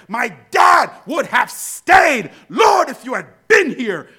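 loud, building litany of complaints; impassioned preaching. Acted. Preacher voices people blaming Jesus for their troubles, impassioned. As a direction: cry out each plea, punching "been here".

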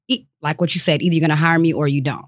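ordinary delivery

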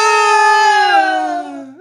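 high yelped no; mock shout. Co-host voicing the patient refusing to wake, high yelp. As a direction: yelp no and drop back out. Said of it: "He's like".

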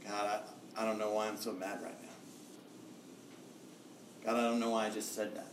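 hushed, fast frustrated confession to God. Retold or imitated. Pastor retelling his own exasperated prayer, hushed and quick. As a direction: mutter it like a guilty aside to God. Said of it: "and I'd be like".

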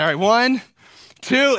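mock-tense countdown. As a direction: build the suspense to the reveal. Countdown before the prank.

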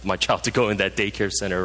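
low, fast offhand parent; quick low dismissive aside; relieved parent. Retold or imitated. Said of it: "some of you parents was like".

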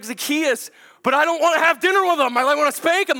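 high, loud comic outrage; exasperated rant. Acted. Dad finding silent son up the tree, exasperated.

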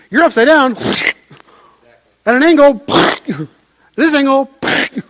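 high, loud words broken by laughter; real laughter bubbling over the words; laughing. Real, not acted. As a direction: laugh through it as the plane flips. Laughing while describing plane tumbling.

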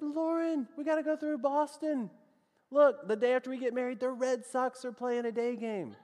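high-pitched, excited coaxing; eager pitch. Retold or imitated. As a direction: pitch the plan to your wife with eager excitement. Eagerly pitching game to new wife.

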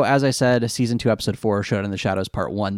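ordinary talk

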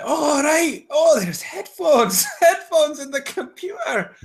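put-on high, slow Scottish old-lady voice with a laugh breaking through; hammed-up Scottish granny realizing there are headphones plugged in; Scottish accent. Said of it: "Your Scottish accent is fantastic".

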